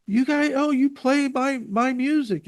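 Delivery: high, eager quoted musician; lightly voiced delighted musician asking; mock thrilled question about his music; excited musician.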